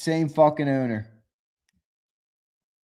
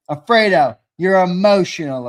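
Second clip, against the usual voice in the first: teasing call-out; 8 dB louder than usual. Real, not acted. Teasing Alfredo loudly.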